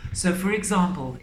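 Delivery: normal talking voice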